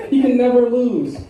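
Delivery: ordinary talk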